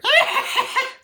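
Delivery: loud, very high staccato cackle; mock evil cackle. Chucky lurking in the darkroom, high mock cackle. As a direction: imitate a killer doll's shrieking laugh. Chucky laughing behind photographer.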